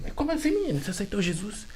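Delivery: high astonished mother's voice; mother hearing her son accepted Jesus, high and astonished; surprised mother. Retold or imitated.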